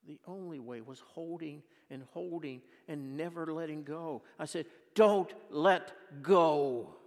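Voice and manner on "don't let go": loud, slow, every word pressed; emphatic charge. Acted. Urging the congregation to hold on, loud and slow. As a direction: drive it home to the congregation. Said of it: "preacher's charge to congregation".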